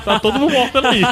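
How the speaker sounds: laughing. Retold or imitated.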